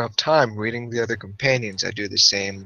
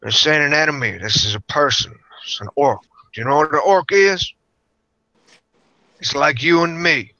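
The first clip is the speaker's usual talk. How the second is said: loud, raised protest; heated in-character argument; 4 semitones higher, 5 dB louder than usual. Acted. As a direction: push back hard, voice up. In-character argument over the orc.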